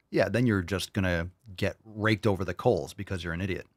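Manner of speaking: ordinary talk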